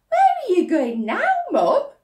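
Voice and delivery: put-on a keen girl asking; eager girl. Laura asking.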